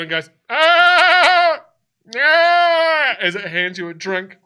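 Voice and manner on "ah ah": loud, high goat bleat. Acted. Goat looks at the player and goes, loud high bleat. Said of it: "the face of a goat".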